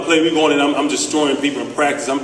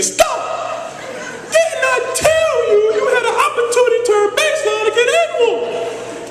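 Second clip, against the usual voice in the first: Coach Chaney's voice. Retold or imitated. Coach scolds his player for not going baseline, squeaky shouting. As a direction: yell like an exasperated old coach, voice cracking high. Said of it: "he speaks like this".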